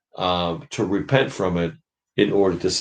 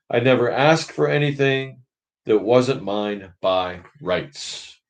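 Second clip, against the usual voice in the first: self-righteous big ghost; 5 semitones higher than usual. Acted. Big ghost defends his record to the spirit, self-righteous. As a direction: insist you earned your place.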